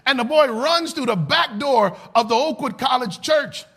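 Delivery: ordinary delivery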